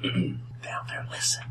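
hushed whisper. Acted. DM whispering down the corridor, hushed. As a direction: breathe it like a secret. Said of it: "DM whispers a hint".

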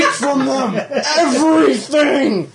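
laughing battle cry. Acted. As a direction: shout it while laughing.

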